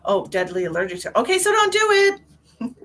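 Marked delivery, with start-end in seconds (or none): 1.2-2.8 high, loud, slow alarm; alarmed warning. Real, not acted. Vlogger warning viewer about allergy, high and loud. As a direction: sound the alarm, drawn out.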